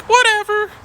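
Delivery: high, bratty whatever; mocking a kid's shrug; dismissive student. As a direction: toss it off like a bored teen.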